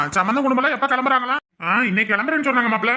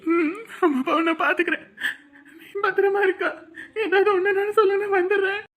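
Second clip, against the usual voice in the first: high voice breaking with sobs; choked, weepy goodbye; tearful reassurance; 8 semitones higher than usual. Acted. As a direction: promise through crying. Sibling answers tearfully at farewell.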